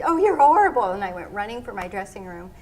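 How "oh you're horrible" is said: high, loud mock outrage; laughing protest. Skater protesting her partner's teasing, high and loud. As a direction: squeal it through laughter and tears. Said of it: "I said".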